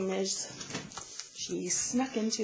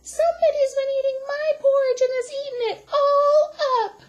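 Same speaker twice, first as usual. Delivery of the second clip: very high, fast, loud little squeal; Baby Bear voice; 8 dB louder, much faster than usual. A narrator's reading. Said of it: "said wee little Baby Bear".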